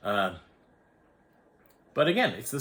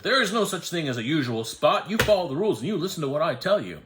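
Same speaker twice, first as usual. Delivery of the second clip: louder, higher, fast scolding lecture; hammed-up bossy attendant, pitched up; mock stern rule enforcer; 6 semitones higher, 6 dB louder than usual. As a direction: imitate the attendant laying down the rules, quick and loud. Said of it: "And he said".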